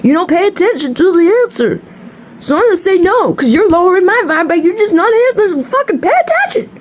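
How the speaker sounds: rising high, fed-up telling-off; exasperated scolding. Retold or imitated. Reader telling off a client who won't listen, exasperated. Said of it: "And it's like".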